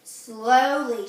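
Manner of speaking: usual speaking voice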